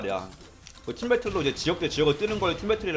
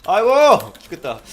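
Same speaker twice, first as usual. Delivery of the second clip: laughing groan; 8 semitones higher, 7 dB louder than usual. Real, not acted. Laughing at teammate's record.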